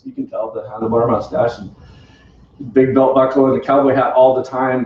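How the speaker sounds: ordinary talk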